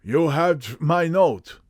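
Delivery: put-on slow, marked German accent; strong German accent. Masked visitor asking the detective, strong German accent. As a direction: ask it slowly, heavily German. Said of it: "deep, harsh voice and a strongly marked German accent".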